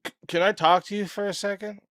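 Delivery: a hint of a guy calling someone over; Glenn's casual call-over. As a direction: toss it off like a friendly, offhand summons. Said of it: "that's the way he said it".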